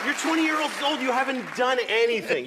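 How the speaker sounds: high, loud comic rant; ranting against twenty-year-olds, loud and high. Real, not acted. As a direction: build the outrage up high and loud.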